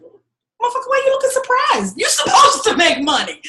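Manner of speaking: high, loud disbelieving yell through laughter; incredulous yell. Retold or imitated. To a client who made money, a laughing incredulous yell. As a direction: yell at him for being surprised he got paid.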